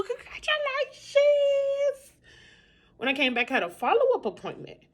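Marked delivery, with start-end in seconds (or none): 0.0-2.1 high, slow drawn-out congratulations; sing-song congratulations. Acted. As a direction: stretch it out high and sweet. Shout out to friend.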